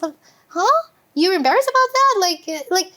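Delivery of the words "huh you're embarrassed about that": surprised huh. Retold or imitated. Husband surprised at embarrassment.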